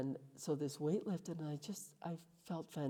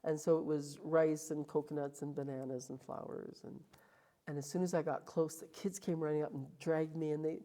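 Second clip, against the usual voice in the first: ordinary delivery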